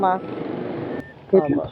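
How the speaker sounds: usual speaking voice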